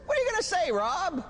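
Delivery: high-pitched goading question; put-on needling demand; mock challenge. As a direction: press him for an answer, pitched high. Acted challenge to beaten Rob.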